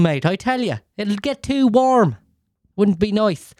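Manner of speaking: high-pitched Irish moaning, lilting down onto each "mate"; put-on Irish accent. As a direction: complain in a hammed-up Irish brogue. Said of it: "what fucking accent I'm doing".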